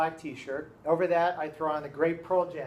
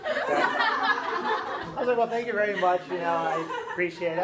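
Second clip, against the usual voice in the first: real laughter spilling into his reply; laughing; 2 semitones higher than usual. Real, not acted. Teacher cracking up telling his class the story.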